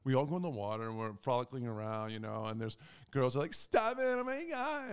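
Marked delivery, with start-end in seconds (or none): 3.5-4.9 mock girly voice. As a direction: imitate girls squealing playfully, slow and high.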